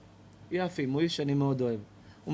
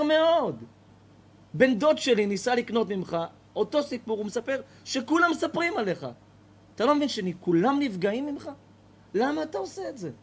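higher and louder, pressing the accusation; indignant confrontation; 7 semitones higher, 3 dB louder than usual. Acted. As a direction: confront him, hurt on others' behalf. Neighbour confronts the old man.

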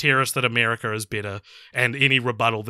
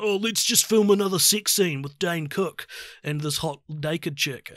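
higher-pitched bro impression; mock film bro; 4 semitones higher than usual. Crew on a bro-y set, mock enthusiasm. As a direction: imitate an eager bro on set.

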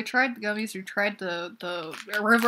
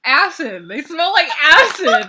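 loud, disgusted call; 6 semitones higher, 11 dB louder than usual. Real, not acted. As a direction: shout the disgust out. Reacting to sour smell.

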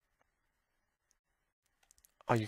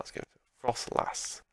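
ordinary delivery

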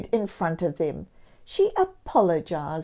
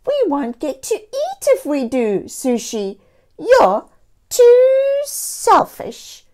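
a cat friend calling her selfish; accusing cat; 9 semitones higher, 9 dB louder than usual. A narrator's reading. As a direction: stretch out too selfish in reproach. Cat character Brown voiced.

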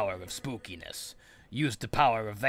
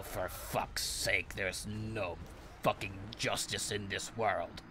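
ordinary talk

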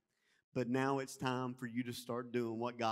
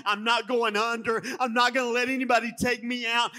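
louder, higher preaching that climbs to the end; full-force preaching; 6 semitones higher, 8 dB louder than usual. Acted. Pastor rallying the church to serve wholeheartedly, preaching hard. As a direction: declare it with all your heart.

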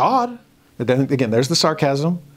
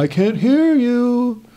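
mock can't hear; 10 semitones higher, 3 dB louder, slower than usual. Mocking a god who won't answer.

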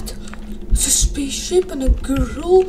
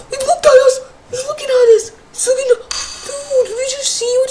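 higher, fast panicked yelp; frightened cry; 7 semitones higher, faster than usual. Real, not acted. Gamer crying out to viewers as the skeleton looks.

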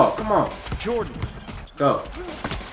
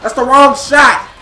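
angry complaint; 7 semitones higher, 8 dB louder than usual. Real, not acted. Yelling at the game.

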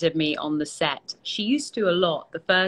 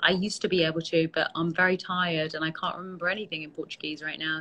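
ordinary talk

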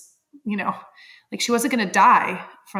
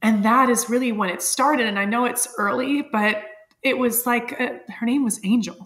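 ordinary talk

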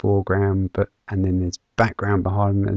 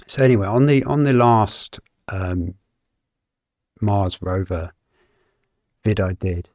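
hoarse, croaky throat. Real, not acted. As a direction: speak through a croaky throat. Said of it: "I'm a bit croaky today".

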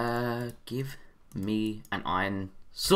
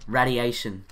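ordinary delivery

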